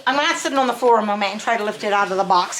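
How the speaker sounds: ordinary delivery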